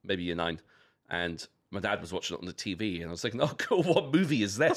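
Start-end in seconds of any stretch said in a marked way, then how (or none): 3.4-4.8 laughing. Retold or imitated. Retelling own naive reaction.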